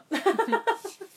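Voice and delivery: snorting burst of laughter; snorting laughs. Real, not acted. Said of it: "你刚刚扑哧笑出来".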